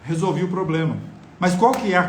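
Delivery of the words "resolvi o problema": ordinary delivery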